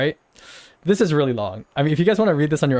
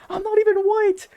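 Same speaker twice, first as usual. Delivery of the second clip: much higher indignant squeal; exasperated squeal. Real, not acted. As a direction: protest in a squeaky disbelieving voice. Exasperated protest.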